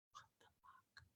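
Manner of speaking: whispered in disbelief; stunned whisper. Retold or imitated. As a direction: breathe it out, stunned. Client stunned by the offer.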